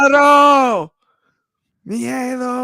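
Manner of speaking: loud call of the name; excited shout. Real, not acted. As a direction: belt out the name. Calling wrestler's name.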